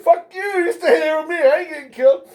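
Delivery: high, loud scared demand; panicked plea. Retold or imitated. Teller to coworker, loud panicked plea. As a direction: beg her to stay, half panicking. Begging coworker stay, frightened.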